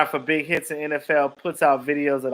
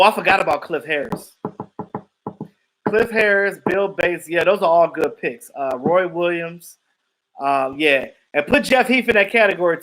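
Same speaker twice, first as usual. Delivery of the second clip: ordinary delivery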